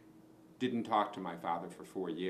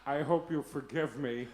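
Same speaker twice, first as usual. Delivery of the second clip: hammed-up dying mother's plea, laughing; mock deathbed farewell through laughter; 3 semitones higher, 3 dB louder than usual. Pete mocking his dead mother to his sister, laughing. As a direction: play the dying parent as a joke, cracking up.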